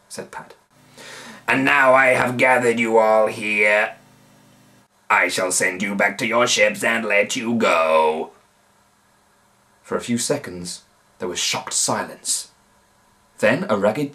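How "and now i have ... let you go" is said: pirate chief announcing to the crowd. A narrator's reading. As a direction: proclaim it from the stage. Moobeard addresses prisoners.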